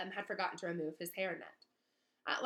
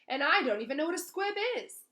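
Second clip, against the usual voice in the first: put-on high, loud indignant boy; boy defending himself to the teachers; indignant protest; 9 semitones higher, 8 dB louder than usual. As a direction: protest your innocence hotly. Harry protesting.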